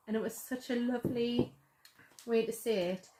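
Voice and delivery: ordinary delivery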